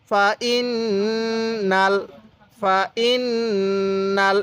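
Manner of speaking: long nasal hum on ইন before নাল; ghunnah recitation. Shown as a demonstration. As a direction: hold ইন through the nose, then land নাল. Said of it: "নাকের বাসি দ্বারা গুন্নাহ করবেন".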